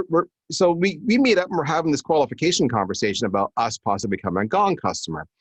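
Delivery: normal talking voice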